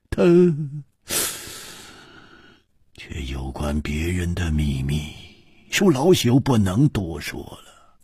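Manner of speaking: low, slightly rueful elder with long pauses; wry old man. A narrator's reading. Old man withholding a friend's secret, low, hesitant and wry. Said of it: "苦笑着说".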